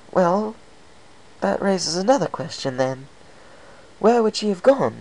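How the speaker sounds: high, bubbly pony voice; chirpy, bouncy high voice; Pinkie Pie voice. Acted.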